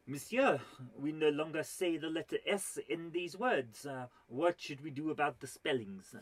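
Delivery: hammed-up French accent; mock French academician posing a question; terrible French accent. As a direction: put on a bad French accent. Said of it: "this is a terrible accent".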